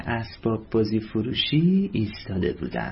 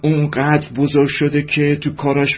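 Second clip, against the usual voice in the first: a louder, hearty father's voice; cheerful father; 2 semitones higher, 7 dB louder than usual. A narrator's reading. Father walking into the girls' room, proud and cheerful. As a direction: boom it out like a proud happy dad. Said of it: "پدر با خوشحالی ... گفت".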